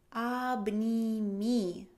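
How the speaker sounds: Обними drawn out very slowly; very slow model. Shown as a demonstration. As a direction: stretch Обними so the learner catches every sound. (Обними, muy despacio)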